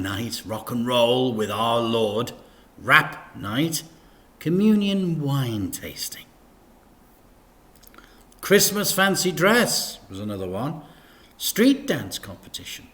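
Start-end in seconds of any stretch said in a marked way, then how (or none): none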